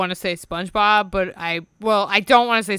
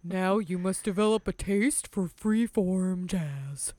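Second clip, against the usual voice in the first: slow, softer dopey Patrick drawl; 3 semitones lower, 5 dB quieter, slower than usual. Retold or imitated. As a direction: say it slowly like dim Patrick. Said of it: "I love your Patrick voice".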